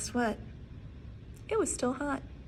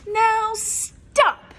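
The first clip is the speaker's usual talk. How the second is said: higher, louder stern order; firm command; 10 semitones higher, 11 dB louder than usual. Acted. Max orders the wild things to stop, loud. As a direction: stop them short like a king. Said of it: "Max said".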